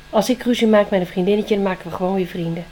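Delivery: fast, tumbling reasoning; rattled off. Acted. Spring reasons to herself about friends, very fast. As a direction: rush through the logic.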